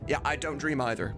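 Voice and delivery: ordinary talk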